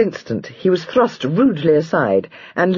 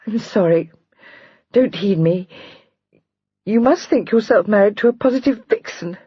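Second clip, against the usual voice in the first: a hint of held-back tears; thickened voice; 7 semitones higher than usual. A narrator's reading. Wife apologises to her husband, near tears. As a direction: apologise as the tears almost come. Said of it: "saying in a thickened voice".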